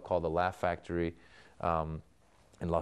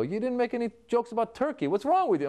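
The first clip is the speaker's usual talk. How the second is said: high, louder mock outrage at being left out; mock offended fan; 14 semitones higher, 4 dB louder than usual. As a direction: play the indignant Turkish fan. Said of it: "a Turk will come up and go".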